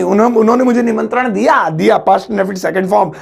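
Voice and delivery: ordinary delivery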